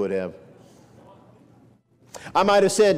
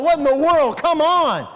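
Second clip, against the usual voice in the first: indignant; 6 semitones higher, 5 dB louder than usual. Retold or imitated. Indignant imagined thought.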